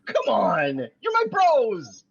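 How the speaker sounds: high, slow, drawn-out plea; pleading whine. Acted. Schmidt begging his roommates, high and drawn out. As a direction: beg them like a hurt buddy.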